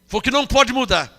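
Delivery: ordinary delivery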